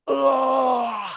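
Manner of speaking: long high mock groan; acted cry of pain. Teller bitten by a goat, long acted groan. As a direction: draw out a high pained "ugh". Reacting to the goat's bite.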